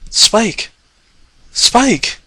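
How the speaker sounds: high, cracking desperate call; voice breaking. Acted. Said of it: "her voice breaking".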